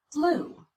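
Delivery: BLUE with a clear pitch glide on the vowel; primary stress. Shown as a demonstration. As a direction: say "Blue" long, pitch jumping high and falling. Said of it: "Listen for that change in pitch".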